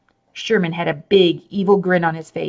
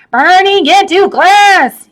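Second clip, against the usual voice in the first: much higher and louder, slow scream; 12 semitones higher, 9 dB louder, slower than usual. A narrator's reading. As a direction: scream it at the boy, losing it. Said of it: "he screamed".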